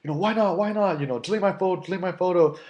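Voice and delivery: high-pitched mock whine; imitating the angry guy. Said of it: "And he's like".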